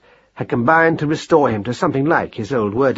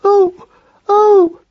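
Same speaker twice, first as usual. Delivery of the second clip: very high, loud "oh"s of agony; pained high wail; cries of pain; 7 dB louder than usual. A narrator's reading. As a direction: yelp high as the hurt foot is yanked. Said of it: "said Marcus Aurelius weakly".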